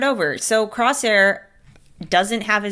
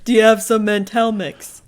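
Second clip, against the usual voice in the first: put-on celebrity voice, asking for food; Patrick Warburton; 3 semitones higher, 4 dB louder than usual. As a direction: keep up the comic impression on a casual question. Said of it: "And he's like".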